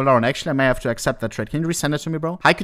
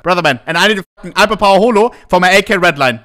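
louder and fast, pumped-up read; hyped offer read; 3 semitones higher, 7 dB louder, faster than usual. Pretend. Streamer reading a pushy viewer's trade offer, louder and fast. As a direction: rattle off the request like an eager hustler.

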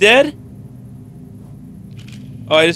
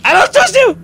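much higher, mocking repeat; mock high squeal; 13 semitones higher, 4 dB louder, much faster than usual. Repeats the line shrilly.

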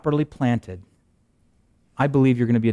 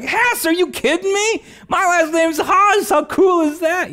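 much higher, louder excited exclamation; 5 dB louder, slower than usual. Retold or imitated. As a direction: squeal with delight at the matching name. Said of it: "I was like".

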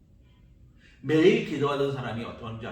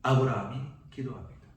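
normal talking voice

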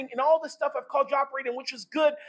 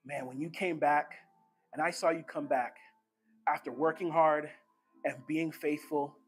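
much lower, soft, intimate murmur; tender Jesus; 9 semitones lower, 7 dB quieter than usual. Acted. Jesus to Kurt, a soft tender murmur. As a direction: lean in and tell him gently. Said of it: "Jesus leaning over to Kurt".

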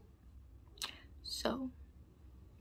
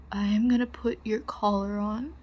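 whispering; 4 dB louder than usual. Acted.

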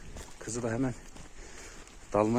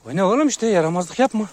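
high, loud telling-off; loud, pitched-up pet reprimand; scolding the dog; 9 semitones higher, 9 dB louder than usual. Real, not acted. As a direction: raise your voice to make the pup behave.

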